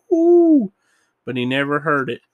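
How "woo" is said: high, loud "woo"; imitated siren wail. A narrator's reading. Bullwinkle waits for the siren, imitated wail. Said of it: "the siren to go".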